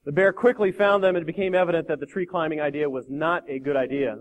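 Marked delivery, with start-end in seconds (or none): none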